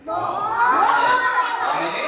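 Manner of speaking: How many drawn out slowly and high; teacher teasing the class about points; playful question. Acted. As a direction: stretch it out teasingly. Quizzing children on points.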